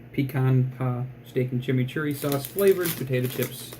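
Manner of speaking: ordinary talk, nothing marked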